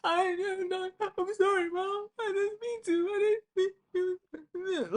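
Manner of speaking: high, tearful hammed-up sob to Mom, slowing at the end; fake crying apology. Kid fake-crying on the phone to Mom, high and sobbing. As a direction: overact the remorse like a child caught out. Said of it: "Oscar nominated".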